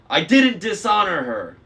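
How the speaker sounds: snapped. A narrator's reading. Luke snapping back at Annabeth in anger. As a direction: snap back at her. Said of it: "Luke snapped".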